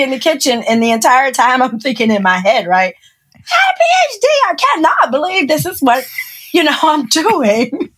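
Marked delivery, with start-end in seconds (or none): 3.3-7.9 high-pitched mock outrage; acted-out disbelief, spelled out indignantly; incredulous inner rant. PhD holder fuming silently in the kitchen, incredulous. Fuming thought while cooking.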